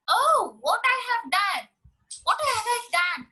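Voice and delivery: high, shocked dismay; dismayed mother. A narrator's reading. Mother realizing she killed the bird, high and shocked. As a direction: gasp out the mother's horror.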